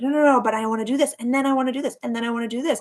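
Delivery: mock eager rush. Fast mock eager rush, listing endless plans. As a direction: rush through like a restless overachiever. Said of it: "We're like".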